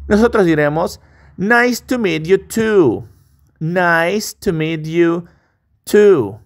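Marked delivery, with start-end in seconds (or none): 1.4-6.4 drawn out, clear teacher model; slow model to repeat. Shown as a demonstration. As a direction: say it slowly so they can repeat. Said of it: "Repite conmigo".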